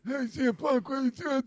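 high caricature of a manager's odd voice; Laurinaitis. Retold or imitated. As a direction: do a high, silly wrestling manager voice. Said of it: "Laurinaitis comes up and he's like".

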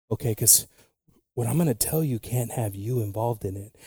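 ordinary delivery

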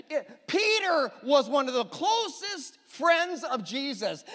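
high, slow shout of conviction; preaching shout. Real, not acted. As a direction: belt it out from the pulpit, slowly. Preaching climax about Peter.

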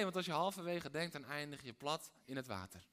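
ordinary talk

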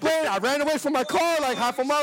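high loud shouting astonishment; amazed street magic reaction. Retold or imitated. Reacting to the tow guy like a street magician, high amazed shouting. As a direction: yell like you just saw a magic trick.

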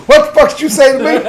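mock angry old man. Old man confronting the whipped cream guy, mock furious. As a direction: play the offended old guy snapping back.

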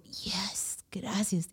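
unvoiced whispered thank-you, relieved.

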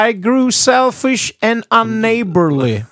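high, loud, drawn-out preacher parody; mock preacher recitation. Mimics the preacher on the record.

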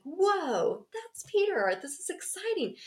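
put-on high, thrilled girl's voice; a young girl overjoyed at the door; excited little girl. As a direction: squeal it out. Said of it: "she got really excited".

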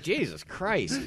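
exasperated exclamation. Retold or imitated. Retold exasperated reaction.

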